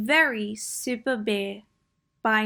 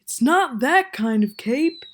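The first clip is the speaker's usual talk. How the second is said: Bear's voice; 3 semitones higher, 5 dB louder than usual. Acted. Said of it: "said Bear".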